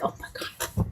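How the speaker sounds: breathy, voiceless whisper of dismay; whispered oh my God. Retold or imitated. To herself after her friend's joke, a stunned whisper. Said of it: "And I'm like".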